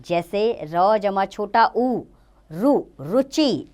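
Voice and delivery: "रु" and "रुचि" spelled out slowly, each sound given its own beat; slow careful demo. Shown as a demonstration. Teacher slowly showing children how to say "रुचि". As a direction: draw out "रुचि" syllable by syllable for the class. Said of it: "उ व ऊ की मात्राएं र वर्ण के पेट में लगती हैं".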